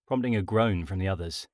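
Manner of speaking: usual speaking voice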